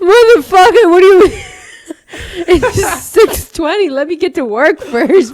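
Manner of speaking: high, loud, laughing complaint; half-laughing annoyed retort; laughing mock annoyance. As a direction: complain while cracking up. Retelling annoyed reply, laughing.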